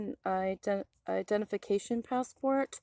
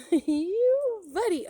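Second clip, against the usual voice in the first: high, slow, laughing coo; a burst of laughter at the little guy; cooing at the turtle while laughing; laughing delight; 11 semitones higher, 6 dB louder, much slower than usual. Real, not acted.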